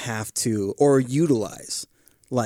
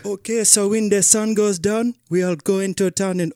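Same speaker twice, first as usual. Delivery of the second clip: African accent, raised pitch; 7 semitones higher, 4 dB louder than usual. Shown as a demonstration. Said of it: "I did an African accent".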